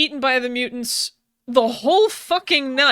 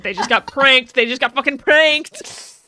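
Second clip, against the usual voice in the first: gleeful outburst; 8 dB louder than usual. Real, not acted. Joking about the prank.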